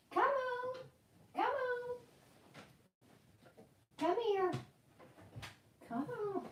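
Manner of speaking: high, fading come-on to a pet; soft high coaxing. Real, not acted. Coaxing her dog.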